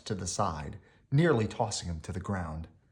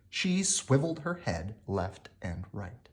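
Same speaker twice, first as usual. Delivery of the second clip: usual speaking voice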